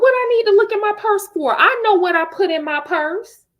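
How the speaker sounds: very high, loud, fast indignant retort; dismissive mom. Retold or imitated. Mother brushing off the father's question, very high and loud. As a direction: snap back like an indignant mother.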